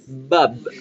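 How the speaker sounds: bub with buzzing voiced b's; English bub. Shown as a demonstration. Teacher models bub the English way, lips vibrating. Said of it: "como se debe pronunciar en inglés".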